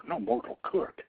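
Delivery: ordinary delivery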